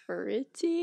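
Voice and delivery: "Pretty" said softly and tentatively, as if words were forgotten; imitating halting speech. Teasing co-host's careful diction, a halting mock "Pretty". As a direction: mimic someone struggling to get out "Pretty". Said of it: "like you forgot how to say words".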